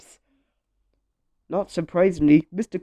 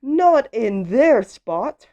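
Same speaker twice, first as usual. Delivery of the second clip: slow, high, loud caution; animated picture-book call; storybook warning; 6 semitones higher, 7 dB louder, much slower than usual. A narrator's reading. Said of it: "And it says".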